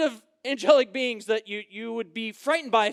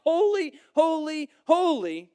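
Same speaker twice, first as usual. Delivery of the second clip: crying out; 5 semitones higher, 4 dB louder than usual. Retold or imitated. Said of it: "crying out".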